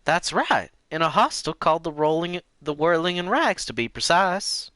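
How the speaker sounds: put-on old lady voice. Lena voice, high.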